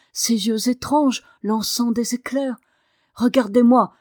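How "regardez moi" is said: loud, defiant command; cri de défi. A narrator's reading. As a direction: demand his attention, voice raised. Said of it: "cria-t-il à Ben Staff". (ordre crié avec défi)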